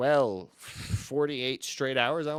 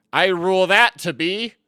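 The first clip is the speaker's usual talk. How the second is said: high, loud mock ruling; hammed-up judge's proclamation; girlfriend playing judge; 7 semitones higher, 11 dB louder than usual. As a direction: pronounce the verdict loudly. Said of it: "hit her gavel and she said".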